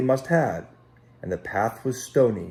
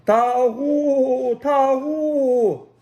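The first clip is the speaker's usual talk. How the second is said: high, loud long calling cry; shepherd's call; 6 dB louder, much slower than usual. Acted. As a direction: call the flock out at sunup.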